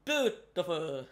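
put-on slow, drawn-out Italian accent. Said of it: "Why did I just do an Italian accent?".

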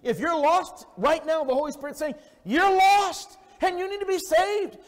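loud, high revival preaching; shouting sermon voice; fiery preaching. Acted. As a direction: preach it at full force to the congregation. Preaching at full force.

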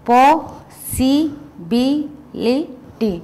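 possibility in five slow beats, po - si - bi - li - ty; very slow possibility, each syllable on its own; split by syllables. Shown as a demonstration. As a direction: break possibility into five separate syllables with gaps. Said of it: "there are five division".

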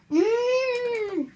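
long, high "mmm" of enjoyment; savouring hum. Real, not acted. Vlogger tastes sweet like a Frutella, savouring hum.

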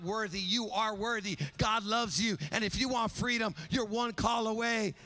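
loud, rising sermon cadence; fiery preaching. Acted. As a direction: preach it with full force to the congregation. Preaching at full force on freedom.

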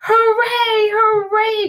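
high, loud hooray; cheering. A narrator's reading. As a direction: cheer at reaching the top. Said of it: "cried the funny little clown".

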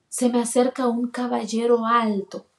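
ordinary delivery

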